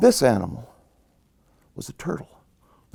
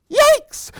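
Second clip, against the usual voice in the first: very high, loud squeal; startled yelp; 6 dB louder than usual. Acted. Rabbit bitten by mosquito, startled high yelp. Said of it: "And the rabbit goes".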